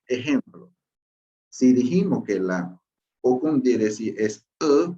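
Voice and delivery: ordinary talk, nothing marked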